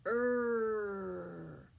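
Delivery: letter R as a growl "Er"; growled er sound. Shown as a demonstration. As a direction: growl the "Er" like an animal. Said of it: "Kind of sounds like a growling sound".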